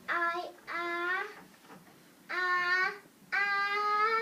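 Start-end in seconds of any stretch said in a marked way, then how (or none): 0.7-3.6 rising "ah"s getting louder; pre-sneeze gasps. Acted. Gerald too late to stop it, pre-sneeze gasps. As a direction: wind up in steps to a big mock sneeze.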